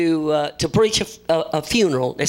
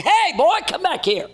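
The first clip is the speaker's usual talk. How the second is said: put-on calling after the boy; 13 semitones higher than usual. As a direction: shout him back, pitched way up. Preacher calls after running boy.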